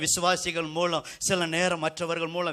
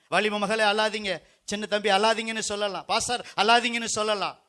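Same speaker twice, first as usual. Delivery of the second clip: raised, insistent pulpit cry; full-force preaching; 6 semitones higher, 7 dB louder than usual. Real, not acted. Preacher tells brothers and sisters God wipes tears, full-force preaching. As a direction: drive each appeal home to the whole hall.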